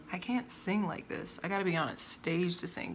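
normal talking voice

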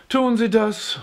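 high-pitched, mildly uninterested question; bored question. A narrator's reading. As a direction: ask with a touch of boredom. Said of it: "fragte Raisa gelangweilt". (leicht gelangweilt fragend)

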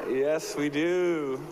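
normal talking voice

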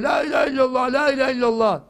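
high chanted yell of the professor; mock professor shouting tevhid; imitated shouting. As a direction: shout it like he did behind the door. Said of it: "Nasıl bağırıyor?".

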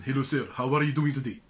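mock Middle Eastern accent on a greeting. Shown as a demonstration. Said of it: "it will sound like".

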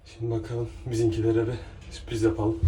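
panting after the climb; breathless, catching air; out of breath. Real, not acted. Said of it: "Nefes nefese kaldım".

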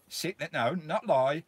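ordinary talk, nothing marked

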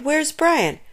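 high-pitched, urgent demand; cried out. A narrator's reading. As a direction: cry out the question, pitch up. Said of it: "Bradley cried".

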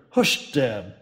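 firm and steady, not shouted; Nancy firmly stopping the drunk captain. Acted. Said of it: "not loudly, but with surprising firmness".

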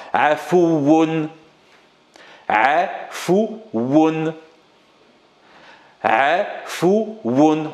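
Afoun drawn out, the ou held long; slow teacher model of Afoun; said slowly. Shown as a demonstration. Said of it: "On le dit doucement".

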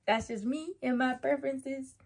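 normal talking voice

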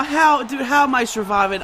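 normal talking voice

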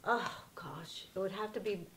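ordinary talk, nothing marked

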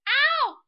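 sudden loud yelp of real pain; a real startled ow; sharp cry of pain. Real, not acted. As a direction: yelp out loud as if you just got hit. Mother hurt by the child.

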